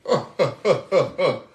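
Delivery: big booming laugh from the heavens; hefty heavenly laugh. Acted. Preacher voicing the Lord laughing at sinners, booming laugh. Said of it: "a hefty laugh from the heavens".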